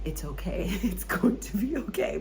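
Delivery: mock-comforting, breaking into a laugh; imitated consoling. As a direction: imitate someone consoling you, amused. Imitates someone consoling her as she cried.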